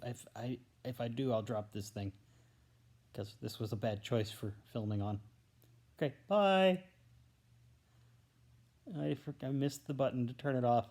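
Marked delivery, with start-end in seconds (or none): 6.0-6.8 high, loud drawn-out bye; sign-off goodbye. Real, not acted. Vlogger ending a rambling video, calling out goodbye. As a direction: call out a raised farewell to viewers. Signing off to the camera.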